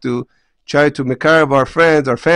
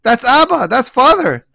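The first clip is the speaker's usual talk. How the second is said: higher, mocking voice of a wife pointing at her husband; mocking mother; 9 semitones higher, slower than usual. Betrayed man mimics his wife taunting him to their children, mocking. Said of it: "she's saying to my kids".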